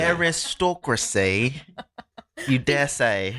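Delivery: put-on slow, high, drawn-out posh accent. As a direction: savour it like a snooty aristocrat. Echoing posh accent joke.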